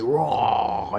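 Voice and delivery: drawn-out mock death roar; imitated monster roar. Gamer imitating the slain rock monster, mock roar. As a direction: roar like a dying rock monster, half-hearted. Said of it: "he was like".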